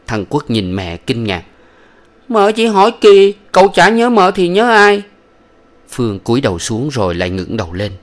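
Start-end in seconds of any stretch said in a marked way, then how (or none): 2.3-5.0 put-on high, louder childlike protest; a small boy surprised at a silly question; little boy voice. Son answering his mother's question, high indignant child voice.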